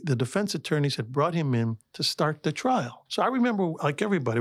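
ordinary delivery

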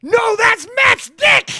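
hype announcer intro. Acted. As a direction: hype him like a superhero. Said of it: "Do Matt".

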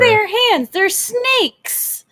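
loud, high disbelieving outburst; incredulous shout. Real, not acted. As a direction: yell it in disbelief.

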